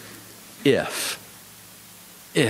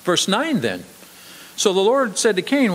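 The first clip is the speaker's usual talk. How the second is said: ordinary delivery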